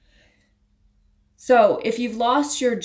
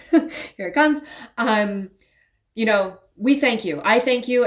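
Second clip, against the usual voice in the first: a laugh, then thanks rising higher and louder as emotion takes over; grateful and close to tears, pitch climbing; getting emotional; 3 semitones higher, 4 dB louder than usual. Real, not acted. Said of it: "I'm gonna get emotional".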